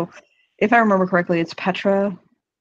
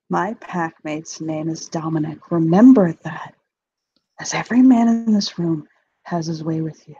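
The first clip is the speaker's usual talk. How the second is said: ordinary talk, nothing marked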